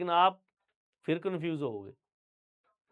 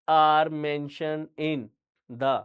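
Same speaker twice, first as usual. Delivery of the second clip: extremely slow, louder, stretched words; slow careful reading; 5 dB louder, much slower than usual. Shown as a demonstration. Teacher repeats the phrase very slowly for the class. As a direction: drag out each word deliberately. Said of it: "many को मैंने many ही बोला है".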